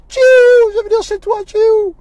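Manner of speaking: high, loud, whiny plea; tearful insisting. Retold or imitated. A weeping caller tells the pastor he is coming over. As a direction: beg to come over, half crying.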